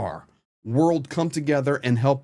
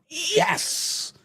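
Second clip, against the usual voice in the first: much higher, airy mock whoop of victory; mock cheer; 13 semitones higher, 4 dB quieter than usual. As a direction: lampoon a hollow victory shout. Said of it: "think we can win this war by just going".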